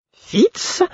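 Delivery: very high, fluting exclamation; small fluty cry; piping fool's voice. A narrator's reading. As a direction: pipe it out far above your own voice. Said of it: "s'exclama-t-il d'une voix flûtée".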